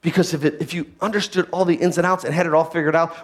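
ordinary talk, nothing marked

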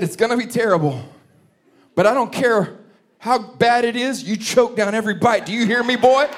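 high, loud mock-stern mother, rising to the last word; playing his mother laying down the law, loud; stern mom order. Mother warning her son to eat everything, mock-stern and loud.

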